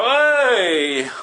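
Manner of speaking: high, loud drawn-out hey; crowd cheer. Acted. Fairgoers answering William's book pitch, loud cheer. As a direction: cheer it out like an onlooker.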